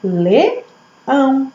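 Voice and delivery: "Leão" split as Le, pause, ão; teacher breaking "Leão" into two syllables. Shown as a demonstration. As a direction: sound out "Leão" in two separate beats. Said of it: "Quantas vezes eu abro a boca".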